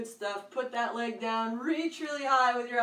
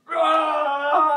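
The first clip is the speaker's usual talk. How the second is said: loud higher roaring shout; giant lion roar; 6 semitones higher, 12 dB louder than usual. Shown as a demonstration. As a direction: roar big for the kids. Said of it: "Five big giant lion roars".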